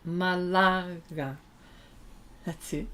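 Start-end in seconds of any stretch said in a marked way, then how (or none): 0.1-1.4 slow, savoured Malaga, stress on the second A; savoured Spanish name. Shown as a demonstration. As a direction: linger over Malaga like you love the sound. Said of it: "I love pronouncing Spanish names".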